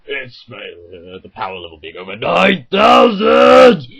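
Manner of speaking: hammed-up Dragon Ball Z shout; mock anime scream. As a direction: yell it like a power-up scream. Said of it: "you hear when he screams".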